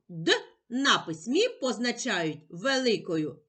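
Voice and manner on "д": «д» as a short hard d, not the letter name; isolated consonant sound. Shown as a demonstration. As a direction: say just the sound of «д». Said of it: "звук".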